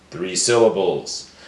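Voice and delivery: ordinary talk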